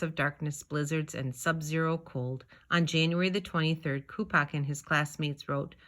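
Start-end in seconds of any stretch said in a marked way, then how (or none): none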